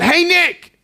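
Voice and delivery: high, loud call imitating a girl; yelling out. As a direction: holler to get someone's attention. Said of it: "who yells out".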